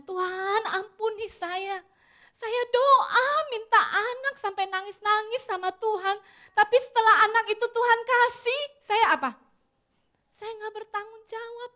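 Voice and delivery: a retold mother's weeping confession to God; tearful prayer. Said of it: "Dia nangis sama Tuhan".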